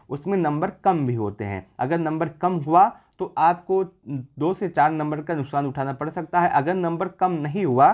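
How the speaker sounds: ordinary delivery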